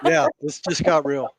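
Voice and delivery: amused laughter through the line; laughing. Real, not acted. Echoing the host's remark, laughing.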